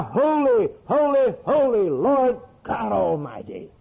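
high proclaimed cry, dropping at the end; crying out in awe. Acted. Preacher voicing seraphim before God, crying out in awe. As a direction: proclaim it like worshipping seraphim, falling away at the close. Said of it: "They cry".

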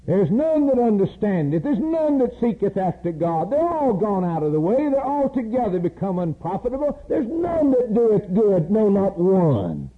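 preaching at full force, slowing; fervent scripture cry. Real, not acted. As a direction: proclaim it, then drag out the last words.

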